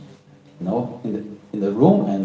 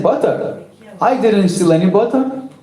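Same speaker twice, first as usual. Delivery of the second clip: put-on higher, indignant little boy; child denying; 7 semitones higher than usual. A narrator's reading. Krishna denies stealing butter to his mother, high and indignant. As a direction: protest your innocence like a child.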